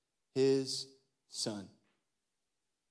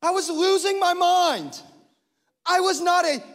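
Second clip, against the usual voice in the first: preached exasperation; 10 dB louder than usual. Acted. Preached story of airplane chaos.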